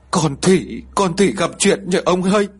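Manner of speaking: a touch of choking sobs in the voice; choked with tears. A narrator's reading. Mother tells husband her daughter is in trouble, mildly tearful. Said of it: "Bà liền nghẹn ngào rồi nói".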